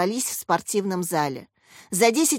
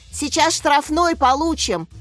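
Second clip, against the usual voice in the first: urgent higher-pitched exclamation; exclaiming; 6 semitones higher, 3 dB louder than usual. A narrator's reading. Mukhin warning of a penalty, exclaiming. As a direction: cry out a warning mid-game. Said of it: "воскликнул Мухин".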